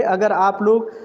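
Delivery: ordinary delivery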